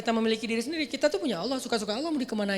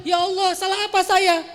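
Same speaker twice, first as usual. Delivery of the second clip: higher, louder aggrieved outcry; indignant demand; 9 semitones higher, 8 dB louder than usual. Shown as a demonstration. Preacher mocks a believer demanding what they did wrong. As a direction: demand it like you were wronged.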